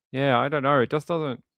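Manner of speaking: ordinary talk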